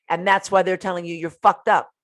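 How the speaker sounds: ordinary delivery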